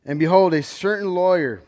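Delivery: loud, measured Bible reading; solemn reading of Genesis; scripture reading. Acted. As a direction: proclaim the verses to the congregation. Reads Luke aloud as scripture.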